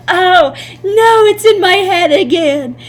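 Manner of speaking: put-on high, loud whiny kid voice; mock whining kids. Teacher imitating older students complaining, high whine. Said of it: "'cause they go".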